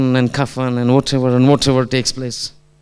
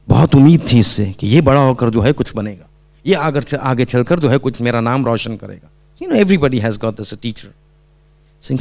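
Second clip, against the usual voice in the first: usual speaking voice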